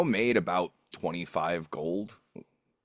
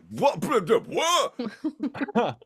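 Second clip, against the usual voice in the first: higher, slow, laughing what; stunned disbelief; much slower than usual. Retold or imitated. He reacts to learning the meme voice's owner, laughing disbelief. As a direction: sputter in amused disbelief. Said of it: "I was like".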